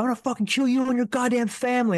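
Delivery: threatening yell. Retold or imitated. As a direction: snarl it like the intruder at the door. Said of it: "He goes".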